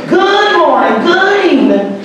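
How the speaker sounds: Good morning sung high and bright; grandma's sing-song greeting. Retold or imitated. Grandson imitating grandma greeting every caller. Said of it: "she would say".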